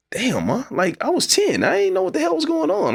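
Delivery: very high, strained pleading to mom; high-pitched exasperated plea; pleading protest. Retold or imitated. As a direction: plead up high like a hurt kid. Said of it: "And I'm like".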